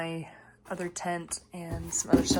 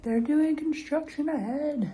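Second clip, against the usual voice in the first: high, drawn-out mock holler; imitated called-out warning; 9 semitones higher, 7 dB louder than usual. Nice man warns hiker of construction, imitated high holler. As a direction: imitate someone calling slowly from a car. Retelling driver's warning from his car.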